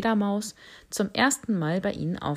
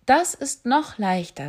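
higher, louder proud bat; 6 semitones higher, 5 dB louder than usual. Acted. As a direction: bat sure of herself. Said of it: "sagte die Fledermaus". (hohe, stolze Fledermaus)

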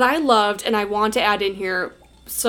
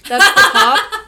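laughing; 8 semitones higher, 8 dB louder than usual. Real, not acted. Joking about Urkel's uncle.

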